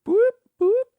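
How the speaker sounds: high, loud siren-like whoop whoop; police siren whoop. Pretend. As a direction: wail it like a cop siren.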